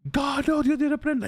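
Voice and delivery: high-pitched, horrified cry to God; alarmed rebuke. Retold or imitated. Pastor grandfather facing the creature, crying out in alarm. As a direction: cast it out in a high, strained voice.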